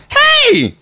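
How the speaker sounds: very high, loud squealed shout; cartoonish high-pitched dude; Hungry Dude. Shown as a demonstration. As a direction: yelp it far above your own pitch. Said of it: "now Hungry Dude is".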